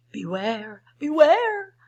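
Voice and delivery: loud high warning call. Acted. Bees call to the bear, loud warning. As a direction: shout a warning. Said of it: "called the bees to the bear".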